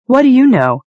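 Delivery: high, loud angry retort; furious challenge. Acted. Yelena rounding on Cahill in the street, furious. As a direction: snap it at him, up and loud.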